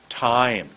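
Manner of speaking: "Time" held long, falling at the end; a slow, stretched "Time"; lengthened word. Shown as a demonstration. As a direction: draw "Time" right out. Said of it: "Look how long that word is".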